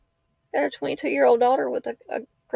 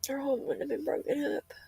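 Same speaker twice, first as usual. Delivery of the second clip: choked-up, softer and higher voice; holding back tears; crying; 4 semitones higher, 3 dB quieter than usual. Real, not acted. Talking to daughter Lexi, tearful and choked up. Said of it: "I don't really cry like this".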